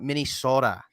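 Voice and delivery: "Minnesota" as "minni-SOH-ta" with a long O; Minnesotan pronunciation. Shown as a demonstration. Guest showing how locals call it, long-O "Minnesota". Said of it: "They're gonna say it just like that".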